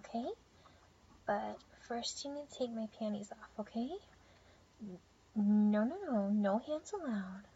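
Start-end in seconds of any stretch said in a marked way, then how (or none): 5.4-7.4 playful scolding. Acted. Playfully stopping him.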